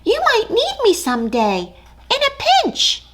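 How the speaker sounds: eager tiny mouse; brave little mouse. Acted. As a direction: puff up small and bold. Mouse voiced in story.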